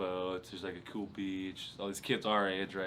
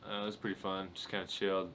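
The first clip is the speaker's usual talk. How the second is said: ordinary delivery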